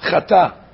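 "Chata" with the aleph swallowed, one word; slurred chata. Shown as a demonstration. Rabbi showing students the slurred blessing. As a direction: run it together as "Chata". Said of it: "There's no word chata".

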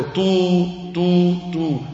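three long, held toots; sustained, even "tu" notes; imitated long shofar cry. Shown as a demonstration. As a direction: sound out three drawn-out horn blasts. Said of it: "So you have to go".

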